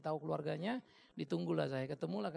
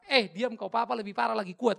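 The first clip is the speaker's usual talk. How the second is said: mock scolding father; 4 semitones higher, 9 dB louder than usual. Father scolding child, laughing.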